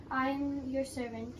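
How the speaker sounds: normal talking voice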